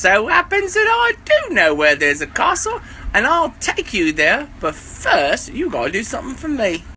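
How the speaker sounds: high, loud bee voice; bumblebee voice. Acted. Bumblebee teasing the rabbit about the castle.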